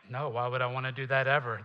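quick, odd-voiced mock protest; reluctant listener. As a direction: toss it off like a lazy churchgoer. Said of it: "Some of y'all were like".

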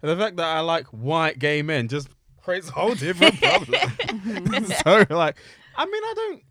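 giggling through the words; amused laughing talk. Real, not acted. Laughing while telling.

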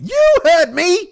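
very high, loud, drawn-out screech of an impression; badly done, over-the-top screechy voice; LaWanda Page. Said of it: "That was my LaWanda Page impression".

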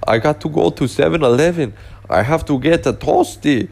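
put-on retelling a German friend's late-night craving in a thick German accent. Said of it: "he had this thick German accent".

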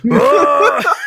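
very high loud yell; villain yell. Acted. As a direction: shriek like a villain. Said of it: "different voices for the Scorpion".

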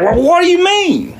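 very high, incredulous squawk; hammed-up outraged question, pitch leaping; alarmed disbelief. Miner learning his secret will get out, alarmed.

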